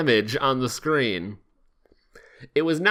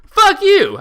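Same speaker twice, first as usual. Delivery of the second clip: high, loud furious yell; outburst; 9 dB louder than usual. Acted. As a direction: blast it at her, much higher and louder. Sonic's outburst.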